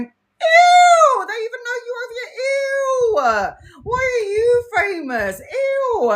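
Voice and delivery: very high, whiny teasing squeal; mocking siblings. Siblings teasing her in Costco, high whiny squeal. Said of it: "my siblings are going".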